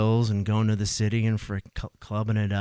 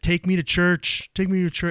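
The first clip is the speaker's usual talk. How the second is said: raised, high, insistent re-enactment; retold urgent plea; 10 semitones higher, 6 dB louder than usual. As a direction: act out an emotional demand, loud and high. Retold desperate demand after a fight.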